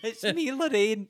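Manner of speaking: high, slow put-on voice; hammed-up TV presenter, pitched high; Lorraine impression. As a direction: draw out a high mock introduction. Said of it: "That's my Lorraine impression".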